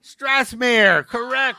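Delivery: shouted out high and loud; loud answer. Real, not acted. Comedian shouting the quiz answer to the crowd, loud. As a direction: call the answer out to the room.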